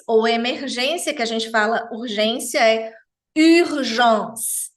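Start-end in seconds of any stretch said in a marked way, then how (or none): 3.4-4.7 urgence com U francês, lábios de U e som de I; pronúncia francesa. Shown as a demonstration. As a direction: diga urgence com biquinho. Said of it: "urgente que você faça o som do U francês". (urgence com biquinho francês)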